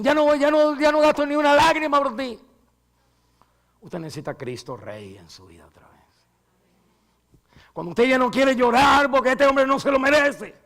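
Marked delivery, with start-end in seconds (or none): 0.0-2.4 high, loud mock defiance; hammed-up bitter refusal; defiant outburst. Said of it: "Hay unos que dicen". 7.7-10.5 high, loud fervent preaching; impassioned sermon climax; preaching shout. Real, not acted. Preaching at full force.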